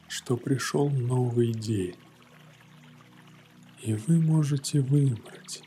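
usual speaking voice

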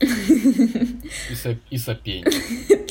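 laughing through the words; giggling as he says it. Real, not acted. Laughing at snoring joke.

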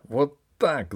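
high, loud furious cry; furious shout. A narrator's reading. Usurper triumphant over the empress, furious shout. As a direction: shout it in a rage. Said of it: "закричал в бешенстве узурпатор".

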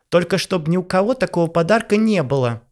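high, loud parting yell; shout. Acted. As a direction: shout after him. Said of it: "крикнула ему вслед Ванесса".

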